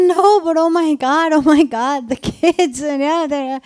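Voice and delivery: pleading, overwhelmed and tearful; distraught outburst. Retold or imitated. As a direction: cry out in helpless distress for the kids. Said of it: "And I'm sobbing".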